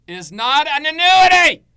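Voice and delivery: loud, high, slow bellow; furious shout. Real, not acted. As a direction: roar the correction at the room. Ranting against the hybrid label.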